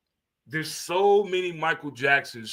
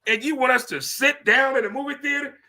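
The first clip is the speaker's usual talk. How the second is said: incredulous; 7 semitones higher than usual. Real, not acted. Incredulous, then laughing.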